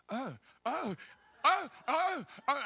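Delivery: high, loud flustered yelps; mock panic. Ticket seller learning he is her dad, hammed-up panic. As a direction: imitate a man panicking at his own blunder.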